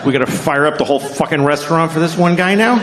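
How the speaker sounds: mock exasperated complaint, climbing to the end; indignant question with rising pitch; put-out staff. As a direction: act an incredulous, put-upon worker. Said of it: "they're like".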